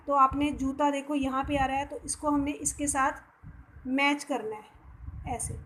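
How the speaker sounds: ordinary delivery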